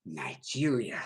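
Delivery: very low, soft, drawn for effect; hushed dramatic reveal. Acted. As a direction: drop it way down like a secret punchline. Dramatic reveal of DNA result.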